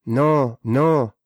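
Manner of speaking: ordinary talk, nothing marked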